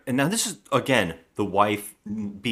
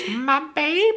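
high hammed-up wail; mock wail. Mother wailing over her dead daughter, high mock wail. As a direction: overplay a grieving mother.

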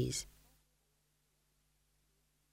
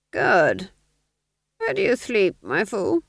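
higher, slow silky probing; sly Duke; 3 semitones higher, 4 dB louder, slower than usual. Acted. Duke slyly probing the fool, slow and silky. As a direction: coax it out of him slowly.

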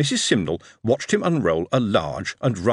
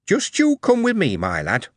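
firm mother; 9 semitones higher than usual. Acted. Mother deciding firmly.

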